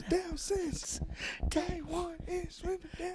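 high, soft mock English accent singing the Usos chant; lightly sung English accent parody. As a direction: croon it in a put-on English accent. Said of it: "in an English accent was crazy".

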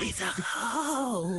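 slow and hushed, drawn out; dragged-out hushed jab. Retold or imitated. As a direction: stretch it out softly. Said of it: "He was like".

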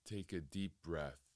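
measured, settled delivery, letting each phrase hang in silence; guided meditation. Real, not acted. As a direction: guide listeners calmly inward, phrase by unhurried phrase.

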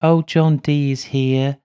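put-on drawn-out eerie girl voice; little girl's voice. A narrator's reading. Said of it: "Madimi says".

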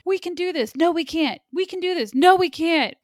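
high, loud back-and-forth argument with oneself; exaggerated imitation of someone wavering; mock up-and-down flip-flopping. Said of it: "She was very up and down for me".

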